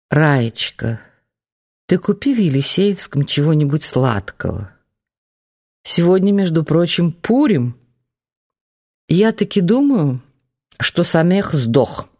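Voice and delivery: put-on high, sly old grandmother voice. A narrator's reading. Old grandmother speaks, Yiddish lilt. (голос старой бабушки)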